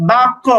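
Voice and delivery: "বাক্য" with rounded, o-coloured bounce; qalqalah tilted upward. Shown as a demonstration. Teacher shows the sound going upward, rounded heavy qalqalah. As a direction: round the mouth on the echo of "বাক্য". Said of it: "একটু জবরের দিকে উচ্চারণ করছি".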